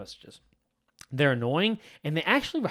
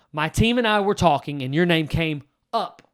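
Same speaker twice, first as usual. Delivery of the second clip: calling them out; 7 dB louder than usual. Shown as a demonstration. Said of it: "I call them up and call them out".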